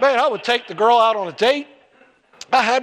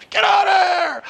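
angry shout; 10 semitones higher than usual. Retold or imitated. Daughter sends him away.

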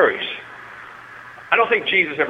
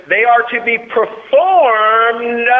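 high, loud, slow preacher cry; drawn-out, ringing preacher finish; 8 semitones higher, 6 dB louder, much slower than usual. Shown as a demonstration. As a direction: stretch it out like a pulpit preacher. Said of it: "It's my preacher voice".

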